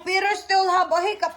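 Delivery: ordinary delivery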